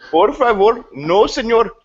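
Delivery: put-on high, laughing Indian accent on Spanish words. Mocking a Spanish phrase for friends, Indian accent. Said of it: "speak Spanish with an Indian accent".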